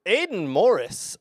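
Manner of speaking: ordinary talk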